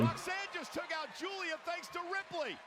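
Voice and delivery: loud, high play-by-play call; excited shout. Real, not acted. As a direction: call the big moment at full volume. Big spot, commentator shouts.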